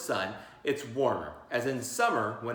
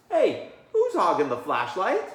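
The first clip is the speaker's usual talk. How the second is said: louder, higher complaint; indignant call; 4 semitones higher, 6 dB louder than usual. Acted. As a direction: call out at the flashlight hog. Child complaining in class.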